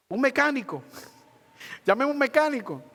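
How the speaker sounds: put-on high, excited child's voice with a laugh; imitating the niece. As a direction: mimic the little girl insisting on a mechanic. Niece insists on a mechanic.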